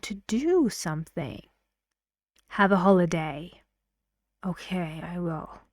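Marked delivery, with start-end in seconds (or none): 2.5-3.6 "holiday" kept down and falling, quick; commanding falling intonation. Shown as a demonstration. Teacher showing an unfriendly command, falling intonation. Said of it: "falling intonations and we sound like we are commanding". 4.4-5.6 reluctant reply. Acted.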